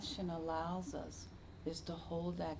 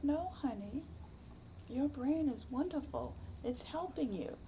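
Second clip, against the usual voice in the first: put-on higher, gentle voice for a child; tender reassurance; 8 semitones higher than usual. Teacher to little boy about his brain, tender and reassuring. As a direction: soothe him like a kind teacher. Comforting kindergartner.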